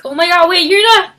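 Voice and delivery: high, loud burst of disbelief; mock shock. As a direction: blurt it out in alarm. Reacting aloud to the plot.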